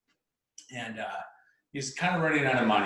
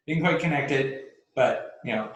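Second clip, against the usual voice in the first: ordinary delivery, nothing marked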